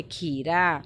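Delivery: खीरा drawn out slowly; slow word model. Shown as a demonstration. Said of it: "यहाँ पर लिखेंगे खीरा".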